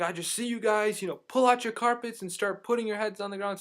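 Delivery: high, loud imitation of a tipsy man; mock-yelling drunk bystander; drunk stranger. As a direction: call it out as a boisterous drunk. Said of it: "he obviously had been drinking a lot".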